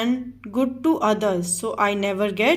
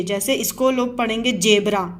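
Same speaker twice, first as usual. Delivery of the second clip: ordinary talk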